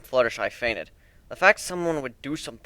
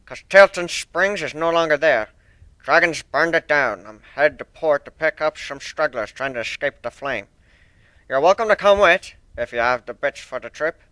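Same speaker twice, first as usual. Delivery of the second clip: put-on loud, stern old sailor with rolled R's; stern sea dog accent; 2 semitones higher, 5 dB louder than usual. One-winged Pegasus apologising to Fluttershy, stern thick accent.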